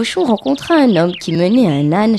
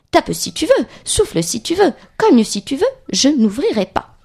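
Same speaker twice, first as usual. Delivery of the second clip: defiant little pig; 6 semitones higher than usual. Acted. Little pig dares the wolf outside, defiant. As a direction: dare the wolf as a lightly voiced piglet.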